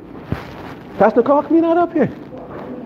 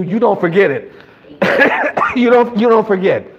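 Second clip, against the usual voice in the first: normal talking voice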